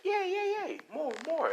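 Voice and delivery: very high, squealing cheers; gleeful villain girl. Acted. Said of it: "clapping her hands like".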